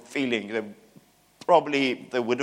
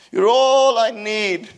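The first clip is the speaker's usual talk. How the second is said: much higher and louder, hammed-up devotion; mock fervent worship; 11 semitones higher, 8 dB louder, slower than usual. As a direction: gush like a swept-up worshipper.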